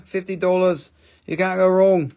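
ordinary talk

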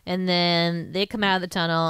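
ordinary talk

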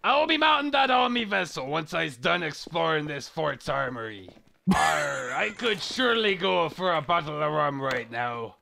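lightly voiced pirate, farmer-ish West Country. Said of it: "He's a pirate!".

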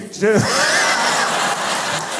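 bursts out laughing at his own joke. Real, not acted. Crowd-work laugh at own joke.

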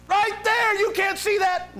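very high, loud squawk of disbelief; hammed-up outraged accuser; infuriated Satan. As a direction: protest at the top of your range. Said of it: "how infuriated Satan gets".

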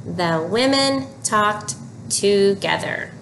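slow, even reading with the class; slow choral reading. Shown as a demonstration. Teacher reads together with the children, slowly. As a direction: read along slowly, word by word. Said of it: "Read with me".

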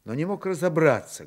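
ordinary talk

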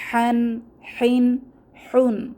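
হান, হিন, হুন with the h pressed from the middle of the throat; throat letter. Shown as a demonstration. Teacher drills sisters on হান হিন হুন, pressed from the throat. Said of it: "কণ্ঠনালীর মধ্যভাগ থেকে ... বাতাসকে চেপে".

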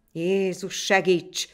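mild narrated war shout; battle cry. A narrator's reading. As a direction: call on heaven as you charge. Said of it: "kiáltották a magyarok".

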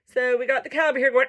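ordinary delivery, nothing marked

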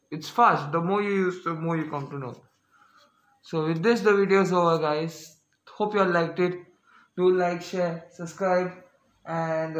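muffled behind cloth mask; speaking through a face mask. Real, not acted. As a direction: mouth covered by mask. Seen 3: Cloth mask covers nose and mouth throughout the labelled words.

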